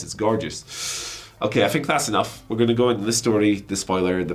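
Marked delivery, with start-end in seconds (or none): 0.0-1.3 slow, soft, drawn-out ASMR murmur; lightly hammed-up ASMR read; mock ASMR voice. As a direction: savour each word like an ASMR video. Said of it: "Listen to this A S M R voice".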